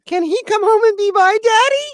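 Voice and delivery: put-on high sweet toddler voice; little girl asking. Toddler in the back seat asking her dad, high and sweet. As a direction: ask it high like a three-year-old. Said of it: "And she actually said this".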